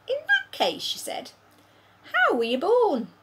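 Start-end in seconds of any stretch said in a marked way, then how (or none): 0.1-0.8 put-on high young girl voice; little girl voice. 2.1-3.1 put-on high young girl voice; little girl voice. Sophie voiced.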